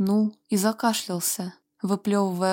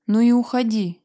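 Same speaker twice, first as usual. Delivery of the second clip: louder, stung and offended; hurt flare-up; 2 semitones higher, 5 dB louder than usual. A narrator's reading. Fiamma to Walter leaving, hurt and louder. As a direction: flare up, wounded. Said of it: "вспыхнула от обиды Фьямма".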